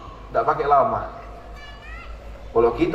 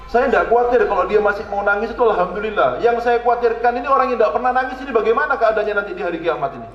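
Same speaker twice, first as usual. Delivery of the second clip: high, impassioned sermon voice building in force; pleading preacher's cry; fervent preaching; 7 semitones higher than usual. Acted. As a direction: preach it with rising urgency. Preacher rising to fervour.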